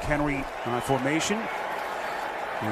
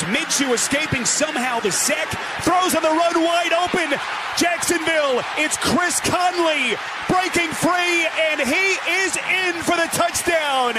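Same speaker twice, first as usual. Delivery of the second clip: excited shout; 2 semitones higher than usual. Real, not acted. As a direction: belt out the big play. Loud touchdown call.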